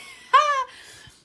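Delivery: high, loud burst of laughter. Real, not acted. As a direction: cackle out loud. Bursts out laughing mid-story.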